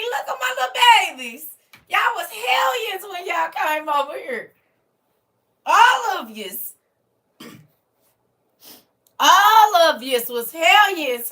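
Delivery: high, loud, slowed, mock-stern praise; proud loud preaching. Real, not acted. Teacher shouting praise at her former hellions, loud and high. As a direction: scold them proudly, full voice. Proudly scolding the class loudly.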